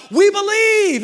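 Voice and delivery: full-volume, very high cheer; crowd shout. Acted. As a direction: shout at the peak of excitement. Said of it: "And they shout".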